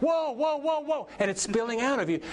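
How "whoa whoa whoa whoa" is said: high, excited exclamations, as if overflowing; overwhelmed whoa. Retold or imitated. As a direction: gasp at the overflowing blessing. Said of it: "And he starts".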